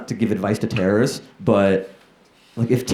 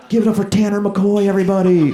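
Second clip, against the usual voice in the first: high, loud arena announcer call; a loud mock arena announcer; hype announcer; 10 semitones higher, 4 dB louder than usual. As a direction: pump up the crowd for the headliner. Concert announcer introduces him.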